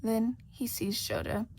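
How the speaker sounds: ordinary delivery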